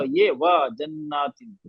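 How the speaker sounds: व जन्नातिन drawn out slowly, doubled न held; teacher showing व जन्नातिन slowly; slow recitation. Shown as a demonstration. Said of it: "वाह करके जन्नातिन पढ़ेंगे".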